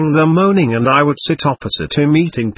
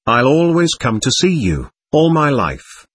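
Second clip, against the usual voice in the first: usual speaking voice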